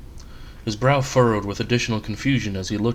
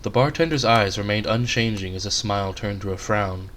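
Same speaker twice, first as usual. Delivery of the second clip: plain reading voice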